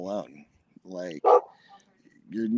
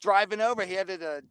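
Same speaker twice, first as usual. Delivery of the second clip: higher pitched mock Australian accent; Aussie accent; 9 semitones higher than usual. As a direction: ham up an Aussie bloke at the pub. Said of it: "give me your best Aussie accent".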